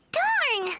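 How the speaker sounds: high annoyed outburst on darn; frustrated darn. Acted. Paimon hitting a dead end, frustrated high outburst. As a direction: kick the dirt with it.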